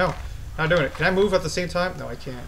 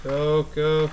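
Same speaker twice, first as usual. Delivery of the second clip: usual speaking voice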